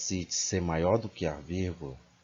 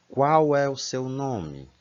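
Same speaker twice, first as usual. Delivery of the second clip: slow, high rising question tune; question intonation; 6 semitones higher, 3 dB louder, slower than usual. Shown as a demonstration. Teacher modelling a question for the class, slow rising tone. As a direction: ask it clearly, pitch climbing. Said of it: "eu já vou usar a entonação de que eu estou perguntando".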